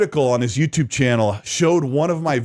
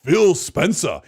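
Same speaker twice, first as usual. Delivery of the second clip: slow, drawn-out shouted announcement, higher; dubbed voice impression; 5 semitones higher, much slower than usual. To his co-host, imitating the AI-dubbed version of his own voice, drawn-out shouting. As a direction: stretch each word out like a bad AI dub. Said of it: "And I was like".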